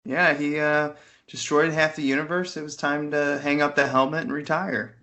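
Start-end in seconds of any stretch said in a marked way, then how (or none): none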